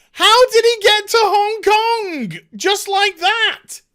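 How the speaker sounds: exasperated disbelief. Real, not acted. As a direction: hurl it at the screen in disbelief. Incredulous rant at the show.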